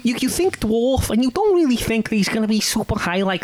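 very high-pitched Scouse accent; exaggerated high Scouse; Liverpudlian accent. Shown as a demonstration. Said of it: "I was gonna do Liverpudlian".

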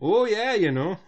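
put-on Midwestern accent. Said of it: "has parents from, like, Wisconsin".

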